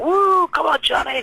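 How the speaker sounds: high-pitched imitation of a cheering crowd; retold crowd cheer; mock cheering. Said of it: "like, cheering".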